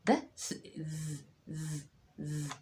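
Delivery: drawn-out buzzing ز; teacher modelling ز against س; buzzed z. Shown as a demonstration. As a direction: buzz "ز" slowly. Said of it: "ده ز".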